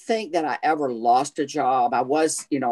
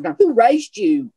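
higher, louder mock reprimand; scolding elder; 7 semitones higher, 4 dB louder than usual. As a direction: scold the kids like a Southern mother. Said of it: "they said".

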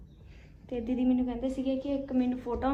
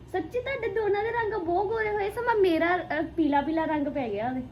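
excited; 6 semitones higher, 6 dB louder than usual. Real, not acted. Excited praise of fair skin.

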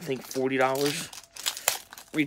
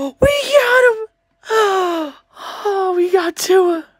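very high, loud screams between gasps; gasp, then shriek the big news, drawn out; real ecstatic scream; 10 dB louder, much slower than usual. Real, not acted. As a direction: scream it out in overjoyed disbelief. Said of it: "I'm gonna scream".

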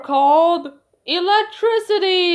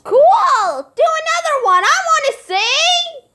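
excited; 14 semitones higher, 7 dB louder than usual. Acted. Kids beg for another trick.

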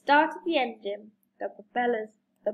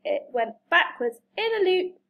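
usual speaking voice

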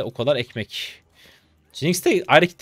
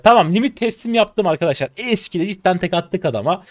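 usual speaking voice

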